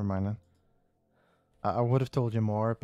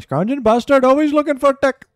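put-on much higher, much louder Indian Scottish accent rant; 12 semitones higher, 9 dB louder than usual. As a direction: bellow it like the Indian Scottish guy in Still Game. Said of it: "He's like an Indian Scottish guy".

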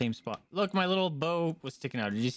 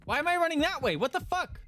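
high panicked squeal. Real, not acted. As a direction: shriek it in sudden panic. Character running uncontrollably.

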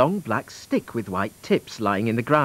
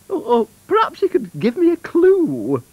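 very high, flustered cow voice; pleading cow; 5 dB louder than usual. Acted. Blue Cow begs the wand for a clue, pleading. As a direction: whine for help in a high creature voice.

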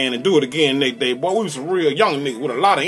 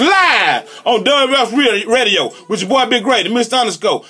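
radio host intro; 5 semitones higher than usual. Acted. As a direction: announce the track like a live DJ.

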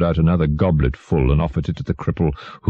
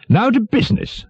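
very high-pitched official voice; fat prime minister. A narrator's reading. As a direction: call the meeting to business.

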